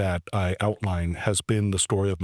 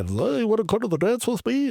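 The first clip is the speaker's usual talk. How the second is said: mock eager ask; 13 semitones higher than usual. Mocking how his ask sounded.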